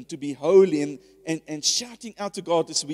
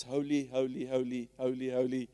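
softer, lower, droning monotone holy; mundane repetition; 5 semitones lower, 5 dB quieter than usual. Retold or imitated. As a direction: chant it like a bored routine. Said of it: "it's so mundane".

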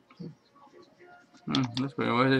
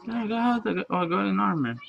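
usual speaking voice

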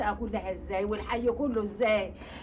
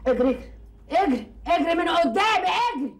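loud, furious yelling, rising higher; angry shouting; 6 semitones higher, 7 dB louder than usual. Acted. Furious wife shouting at the man to run away. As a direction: chase him off at the top of her voice. Woman chasing a man off angrily.

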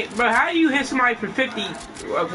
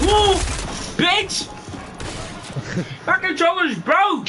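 frustrated shout; 8 semitones higher, slower than usual. Real, not acted. Controller fails mid-fight.

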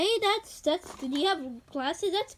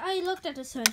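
normal talking voice

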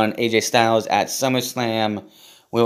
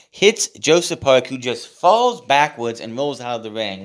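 ordinary talk, nothing marked

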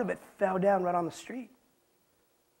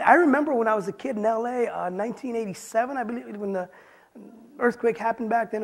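usual speaking voice

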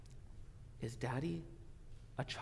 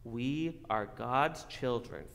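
ordinary talk, nothing marked

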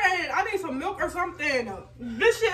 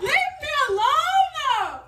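crying; 9 semitones higher, slower than usual. Real, not acted. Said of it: "She is crying".

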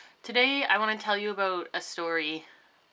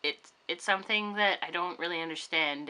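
usual speaking voice

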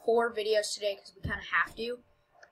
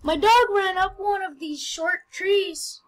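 put-on kid voice; 10 semitones higher, 8 dB louder, slower than usual. Reading kid NPC line.

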